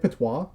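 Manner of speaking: "patois" as pa-TWAH, asking; French pronunciation. Shown as a demonstration. Checking if it's French, "patois" as pa-TWAH. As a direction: say "patois" French-style with silent S. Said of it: "It's not French".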